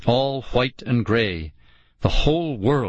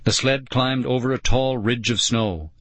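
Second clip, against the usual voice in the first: usual speaking voice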